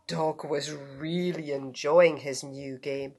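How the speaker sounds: plain reading voice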